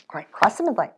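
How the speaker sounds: normal talking voice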